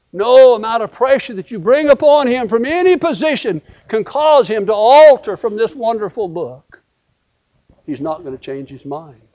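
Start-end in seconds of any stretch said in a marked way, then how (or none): 0.1-6.8 loud, raised preacher's voice; forceful preaching. Acted. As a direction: drive it home to the congregation.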